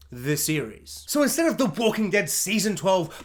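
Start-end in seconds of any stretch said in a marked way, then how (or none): 0.1-1.0 low, softer series title; mock title voice. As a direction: drop low to intone the subtitle. Mock title announcer tag.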